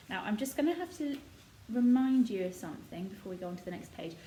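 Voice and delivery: ordinary delivery, nothing marked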